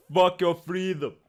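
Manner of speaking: badly faked Austrian accent; mock movie-star Austrian accent; Arnold Schwarzenegger. Film star telling people off, attempted Austrian accent. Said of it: "I can't do his accent".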